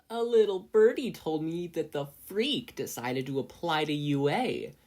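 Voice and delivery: high, loud gloating crow; crowing bully. A narrator's reading. Bully crowing at Izuku about his application. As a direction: crow it out, mocking the freak. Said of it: "Tsubasa crowed".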